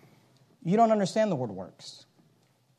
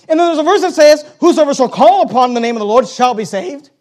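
loud, raised preacher's voice; full-force preaching; 7 semitones higher, 11 dB louder than usual. Acted. Preacher declaring scripture to the congregation, full-force preaching.

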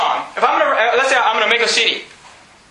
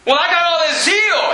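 mock boastful objector; 6 semitones higher than usual. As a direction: play the proud churchgoer who thinks zeal is enough. Imagined objector boasting of zeal.

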